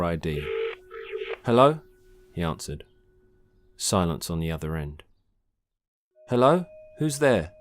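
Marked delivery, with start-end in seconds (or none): 1.4-1.8 higher, louder rising hello; questioning phone greeting. Acted. Sen picking up an unknown call, questioning greeting. 6.3-7.5 raised, higher-pitched query; questioning phone call-out. Acted. Sen calling into silence on the phone, questioning.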